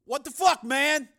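high, loud indignant outburst; angry. Acted. Pimp confronted by an armed stranger, angry outburst. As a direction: protest angrily, pitched far up. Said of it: "said the pimp angrily".

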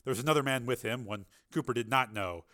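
plain reading voice

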